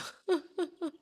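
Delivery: disbelieving laugh breaking out; nervous laugh. Real, not acted. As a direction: laugh on the edge of crying. Laughing at the coincidence.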